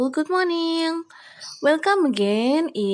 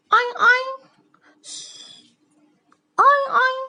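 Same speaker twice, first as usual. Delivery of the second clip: high squeaky oink; pig oink; 13 semitones higher than usual. Pretend. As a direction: oink like a cartoon piglet. Teacher imitating pig grunt.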